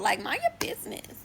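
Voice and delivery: whispered mind-your-business aside. Real, not acted. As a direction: hiss it as a side comment. Aside telling friends to back off.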